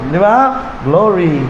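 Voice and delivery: low, gentle, restrained 'glory'; demure women's praise. Shown as a demonstration. Preacher showing women how to respond in church, low restrained praise. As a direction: offer it modestly, without shouting. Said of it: "tipong ganon".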